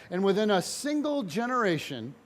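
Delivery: ordinary talk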